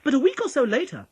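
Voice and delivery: ordinary delivery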